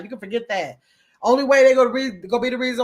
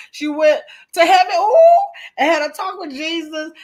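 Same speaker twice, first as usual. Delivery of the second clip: high, loud laughing words; cracking up mid-sentence; 7 semitones higher, 7 dB louder than usual. Real, not acted. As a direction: laugh through the whole line. Cracking up at a comment.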